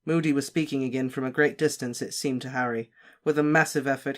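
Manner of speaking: ordinary delivery, nothing marked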